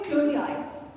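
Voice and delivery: ordinary delivery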